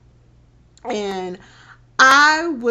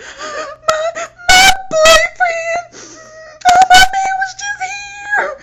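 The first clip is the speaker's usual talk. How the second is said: very high, loud sobbing panic; high pitched crying; 11 dB louder, slower than usual. Retold or imitated. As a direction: wail it high and tearful, barely getting words out. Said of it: "real high pitched tone and me crying".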